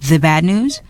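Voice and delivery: usual speaking voice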